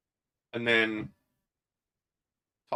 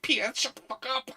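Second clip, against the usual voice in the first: much higher mock snap; quoting Richard; 12 semitones higher than usual. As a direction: shoot it back in a squeaky put-on voice. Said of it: "And he's like".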